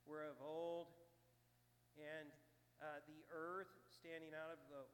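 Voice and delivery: measured, solemn reading of scripture. Acted. Pastor reading scripture to the congregation, solemn and measured. Reads 2 Peter aloud.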